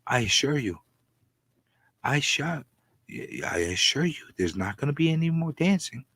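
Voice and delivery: hushed, breathy-close ASMR murmur; ASMR whisper. Acted. Vlogger opening his ASMR bit, soft whisper. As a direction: lean in and whisper it softly. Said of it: "I'm done with the A S M R".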